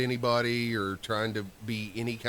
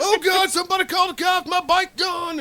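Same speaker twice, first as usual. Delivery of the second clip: panicked shout; 10 dB louder than usual. Retold or imitated. Coworker panics over stolen bike.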